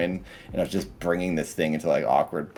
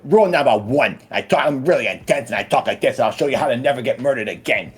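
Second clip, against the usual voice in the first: loud, intense Boston accent, higher; Massachusetts loudmouth; 5 semitones higher, 3 dB louder, faster than usual. Shown as a demonstration. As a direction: bark the rule like a Massachusetts tough guy. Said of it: "a Massachusetts, like, loudmouth".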